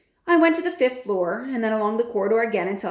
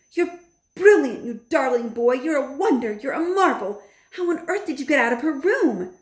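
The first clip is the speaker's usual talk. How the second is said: much higher, louder delighted grandmother; delighted praise; 6 semitones higher, 4 dB louder than usual. A narrator's reading. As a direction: gush with pride.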